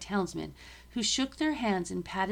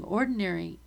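plain reading voice

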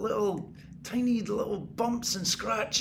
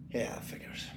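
whisper; 9 semitones lower, 12 dB quieter than usual. Acted. Bitter aside to himself.

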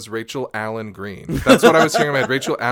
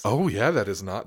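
ordinary talk, nothing marked

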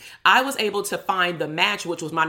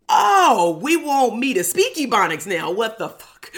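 loud, high outburst of disbelief; exaggerated affronted outcry, easing off at the end; mock indignation; 5 semitones higher, 6 dB louder, slower than usual. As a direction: play up an indignant reaction, starting loud and high. Acted indignant reaction to the request.

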